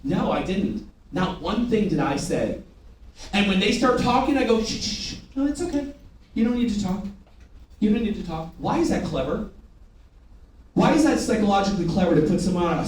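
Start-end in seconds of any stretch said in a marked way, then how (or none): 4.6-8.5 soft, hushed reassurance; gentle shushing. Acted. Salesperson hushing a customer on the mattress, softly soothing.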